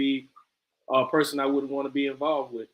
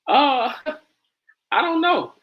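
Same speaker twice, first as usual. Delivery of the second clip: ordinary talk, nothing marked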